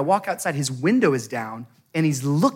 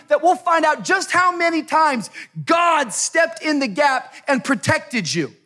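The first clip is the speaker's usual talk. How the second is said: full-force preaching; 10 semitones higher than usual. Real, not acted. Preacher vowing to protect his wife, full-force preaching. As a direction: build it like a fervent sermon, stretching key words.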